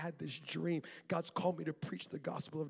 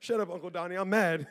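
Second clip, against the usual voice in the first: mock angry snap; 7 dB louder than usual. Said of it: "I got mad".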